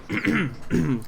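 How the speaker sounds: acted throat-clear before speaking. Boomer stalls before reciting his praise of Gretch, clearing throat. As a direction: clear the throat to prepare a speech. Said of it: "clears his throat".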